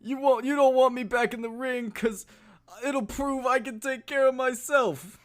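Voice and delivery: proud aging boxer, mumbling; Stallone impression. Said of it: "who has the better Stallone impression".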